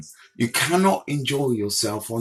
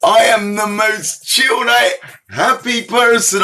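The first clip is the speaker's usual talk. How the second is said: mock indignant; 8 semitones higher, 4 dB louder than usual. Real, not acted. Mock outrage at a commenter.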